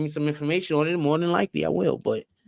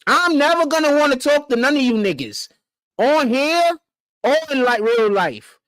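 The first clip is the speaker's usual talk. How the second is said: high-pitched, emphatic refusal; exasperated refusal; 8 semitones higher than usual. Real, not acted. Emphatic refusal to talk to them.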